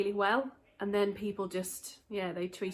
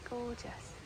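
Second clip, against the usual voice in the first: whispered; 3 semitones higher, 9 dB quieter than usual. Real, not acted. Admiring flowers in the garden.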